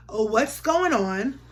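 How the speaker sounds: ordinary delivery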